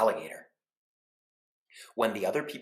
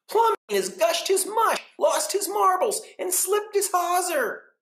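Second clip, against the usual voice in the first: gossiping neighbors; 8 dB louder than usual. Acted. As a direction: act shocked, drawing out each phrase. Neighbors voiced again.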